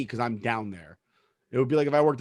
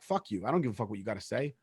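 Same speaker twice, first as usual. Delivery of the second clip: much softer, fast muttered curse; quiet muttered defiance toward the boss; silent inner defiance; 4 semitones lower, 10 dB quieter than usual. Retold or imitated. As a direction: mutter it under your breath, fast. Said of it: "I'd be looking through him like".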